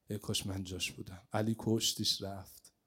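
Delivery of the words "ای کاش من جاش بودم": hushed, longing repetition; fading wistful echo. Acted. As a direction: repeat ای کاش من جاش بودم much softer, almost to yourself. The wish repeated, fading.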